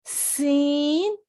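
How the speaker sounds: سین stretched very long; drawn-out seen. Shown as a demonstration. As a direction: hold سین for learners. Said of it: "اب یہاں پہ آ گئے سین". (سین لمبا کھینچ کر)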